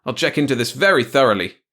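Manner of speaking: snapping. A narrator's reading. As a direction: snap out a warning. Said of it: "he snapped".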